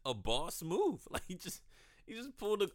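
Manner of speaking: loud, high excited retelling. Real, not acted. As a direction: rave about it.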